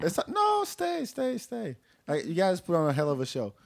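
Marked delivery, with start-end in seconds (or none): 0.1-1.8 pleading. Real, not acted. Begging them to stay, high.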